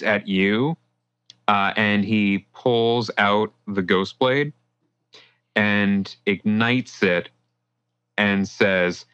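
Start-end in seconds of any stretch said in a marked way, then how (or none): none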